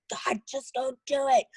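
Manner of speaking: frustrated yell. Retold or imitated. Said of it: "I get so frustrated that I yell at him".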